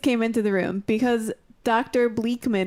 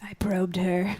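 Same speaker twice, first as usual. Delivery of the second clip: whispering; 3 semitones lower, 4 dB quieter, slower than usual. Real, not acted. Aside whispered.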